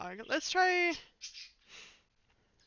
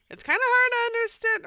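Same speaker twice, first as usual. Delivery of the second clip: much higher, louder and squeaky; 12 semitones higher, 5 dB louder than usual. Acted. Hero struggling to understand the chattering frogs, high squeak. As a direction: squeak it out, baffled.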